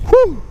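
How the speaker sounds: very high, loud celebratory woo; victory whoop. Real, not acted. Angler celebrating the landed bass, loud high whoop. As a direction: let out a big triumphant whoop.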